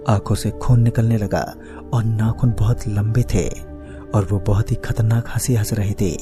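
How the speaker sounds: plain reading voice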